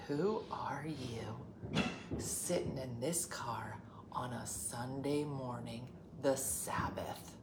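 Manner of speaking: hushed, slow, prim reproach; prim scolding. Retold or imitated. Mother scolding daughter for cursing on the Sabbath, hushed. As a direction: scold primly under your breath.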